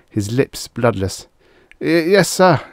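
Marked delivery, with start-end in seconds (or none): 1.8-2.6 high, louder, frightened stammer. A narrator's reading. Frightened young lieutenant answering his commanding officer, stammering. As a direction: trip nervously on the first word, voice pitched up. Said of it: "he said stammeringly".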